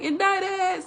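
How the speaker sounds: Indicted squealed high in mock panic; mock panicked Indicted. Daughter busting out the joke, mock high panic. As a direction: copy the movie's frantic cry. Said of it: "she'll just bust out".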